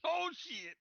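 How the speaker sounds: high, loud, gleeful character voice; a thrilled robot cheering the shotgun blast; excited voice. Acted. Character cheers Evelyn's shotgun, loud and excited. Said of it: "excited voice".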